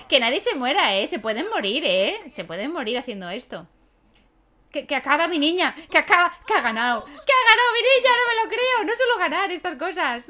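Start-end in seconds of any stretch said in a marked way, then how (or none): 4.7-10.3 much higher, much louder joyful shouting; ecstatic cheer. Real, not acted. Player shouting with joy as her character wins. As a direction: scream with joy at the win.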